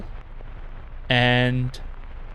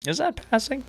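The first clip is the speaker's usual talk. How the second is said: ordinary talk, nothing marked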